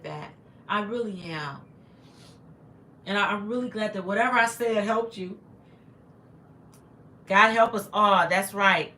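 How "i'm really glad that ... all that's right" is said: ordinary delivery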